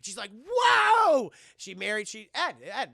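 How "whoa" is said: high, loud startled "Whoa!"; a big shocked yelp; surprised whoa. Retold or imitated. Retold reaction shout.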